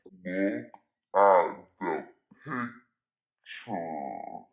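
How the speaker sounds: very low, drawn-out words with long gaps; emphatic deep repeat. Retold or imitated. Telling boyfriend to really look, slow deep emphatic voice. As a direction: spell it out slowly in a mock deep voice.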